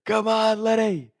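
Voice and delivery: high-pitched cheer of encouragement. Retold or imitated. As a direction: cheer on a runner from the sidelines. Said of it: "So we start cheering".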